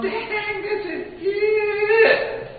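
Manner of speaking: high, drawn-out, amazed; inner delight. Retold or imitated. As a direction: savor the moment in slow wonder. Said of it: "I'm going".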